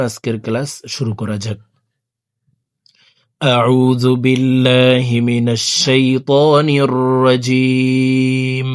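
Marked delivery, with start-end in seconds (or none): 3.4-8.7 slow, drawn-out chanted recitation; slow measured Quran chant; Quranic recitation. Shown as a demonstration. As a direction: intone each phrase slowly like tilawat. Opening recitation before Quran lesson.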